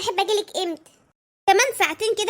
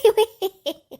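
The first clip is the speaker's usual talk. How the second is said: giggle; 4 semitones higher, 3 dB louder than usual. Acted. Wife giggling at surprise.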